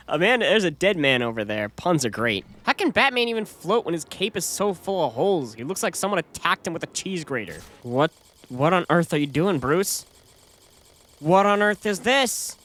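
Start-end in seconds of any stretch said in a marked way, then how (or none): none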